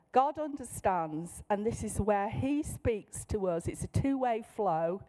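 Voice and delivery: ordinary delivery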